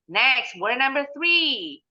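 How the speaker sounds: ordinary talk, nothing marked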